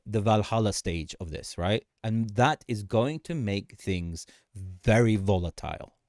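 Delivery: ordinary talk, nothing marked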